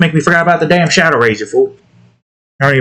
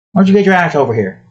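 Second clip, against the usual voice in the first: ordinary delivery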